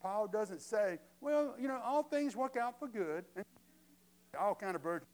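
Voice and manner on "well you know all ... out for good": high, loud mock-cheery voice; glib platitude. Preacher voicing what Paul doesn't say, glib and high. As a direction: toss it off like an empty cliché, pitched up.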